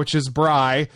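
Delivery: Bry said slowly, like "bry"; sounding it out. Shown as a demonstration. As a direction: spell out Bry as one clear syllable. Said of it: "It's B R Y".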